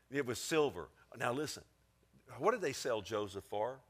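ordinary talk, nothing marked

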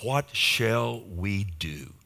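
slow, drawn-out, troubled question; slow reading of the council's question. Retold or imitated. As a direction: let each word hang, spelled out slowly. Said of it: "He said".

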